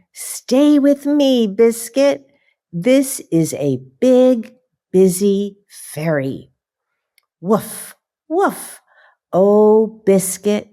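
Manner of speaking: lively, slow picture-book reading with puppy noises; reading to children. Acted. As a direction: read it like bedtime story time for little kids.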